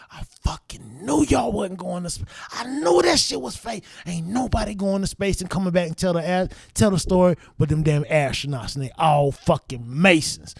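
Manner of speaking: high, rising told-you-so; triumphant rant. Retold or imitated. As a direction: crow about being right. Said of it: "I was like".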